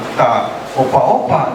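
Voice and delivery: ordinary talk, nothing marked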